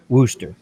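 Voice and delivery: Worcester said woo-ster, clipped; Worcester as woo-ster, two short syllables; local way. Shown as a demonstration. A streamer repeating the local way in disbelief. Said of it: "how the hell do you get W O O S T R".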